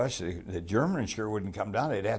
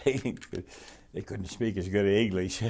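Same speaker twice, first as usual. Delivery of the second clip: laughing; 4 dB louder, much slower than usual. Real, not acted. Laughing recalling the fake guards.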